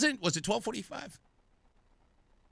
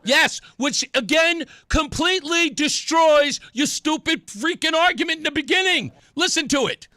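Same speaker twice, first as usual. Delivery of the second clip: loud, high, indignant outburst; real raised-voice exasperation; heated rebuttal; 8 semitones higher, 6 dB louder than usual. Real, not acted. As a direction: shout down the man on the tape. Host shouting back at the clip.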